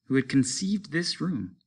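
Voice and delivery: ordinary delivery, nothing marked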